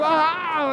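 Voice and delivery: high startled "ah"; scared scream. Retold or imitated. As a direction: re-enact a frightened yelp. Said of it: "Like this".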